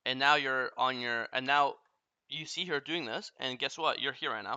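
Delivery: normal talking voice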